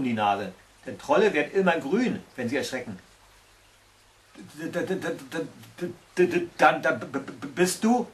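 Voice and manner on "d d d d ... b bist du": stuttering. A narrator's reading. Scared little troll stammering at the slug. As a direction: stumble on every sound in fear. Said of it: "stotterte der kleine Knirps".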